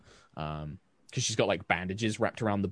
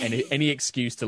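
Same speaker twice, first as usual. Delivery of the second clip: usual speaking voice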